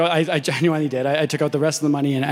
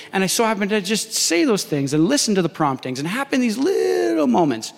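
amazed; 7 semitones higher than usual. Real, not acted. Preacher marvels at the chance.

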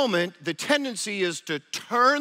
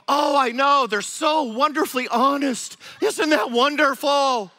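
high, loud, overdone praise of a fault; hammed-up lovestruck excuse-making; gushing admiration; 6 semitones higher, 4 dB louder than usual. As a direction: gush like everything they do is wonderful. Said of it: "And you go".

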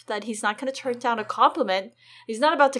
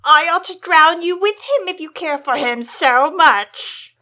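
slow loud threat; 6 semitones higher, 11 dB louder than usual. Acted. Joffrey threatens Sansa.

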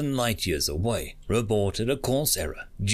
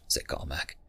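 usual speaking voice

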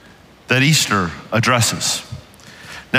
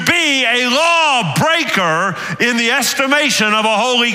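preaching at full force; 9 semitones higher than usual. Acted. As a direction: drive it home from the pulpit.

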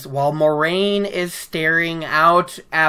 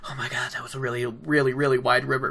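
nervous hush; 4 semitones lower, 8 dB quieter, faster than usual. Retold or imitated. Boys' private nervous reaction.

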